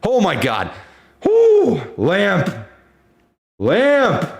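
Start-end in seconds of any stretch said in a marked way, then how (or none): 1.2-1.8 high, loud whoop; excited whoop. Real, not acted. Streamer cheering the stock with a whoop. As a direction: whoop in celebration. Celebrating the rally.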